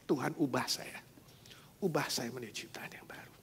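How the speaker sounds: soft, unvoiced plea to God; whispered prayer. Wife repenting before God, in a whisper. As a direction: pray it under your breath.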